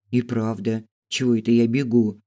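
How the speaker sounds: plain reading voice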